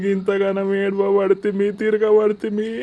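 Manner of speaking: high-pitched tearful pleading; sobbing plea to a lost loved one; wailing grief. Acted. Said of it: "ఏం ఏడుతున్నావురా?".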